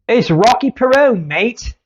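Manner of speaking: hammed-up Aussie, sliding toward Cockney; Australian accent. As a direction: put on an amateur Australian accent. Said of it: "it's my Australian".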